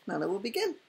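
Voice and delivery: ordinary delivery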